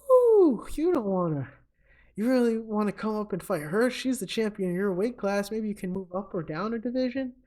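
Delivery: high-pitched teasing caution, opening on a high "ooh"; mock doubt. Danger warns his drinking partner off fighting the champion, mock doubtful.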